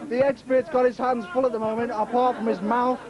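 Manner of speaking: usual speaking voice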